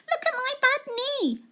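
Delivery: put-on high little girl voice; high-pitched child's "look"; child showing knee. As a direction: show off the knee like an excited child. Claire's repeated line.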